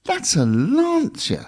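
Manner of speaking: slow, high, disbelieving; drawn-out shocked realisation; astonished. Retold or imitated. Shocked at the badge on an ugly car.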